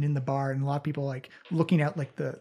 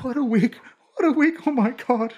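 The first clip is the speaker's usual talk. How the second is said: high, loud hammed-up wailing; openly sobbing; 9 semitones higher, 7 dB louder than usual. Distraught woman to investigators after a bad week, mock sobbing. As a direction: wail it like a distraught client, played up. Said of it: "openly sobbing".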